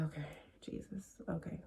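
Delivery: stunned whisper. Retold or imitated. Shocked aside to herself.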